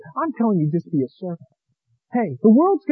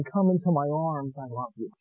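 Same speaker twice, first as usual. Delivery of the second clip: God's words spoken soft and much quieter, fading away; soft hushed voice; 8 semitones lower, 10 dB quieter than usual. Retold or imitated. God speaking to the believer, hushed and soft. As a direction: hush it down like a tender whisper of love. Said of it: "He's just whispering".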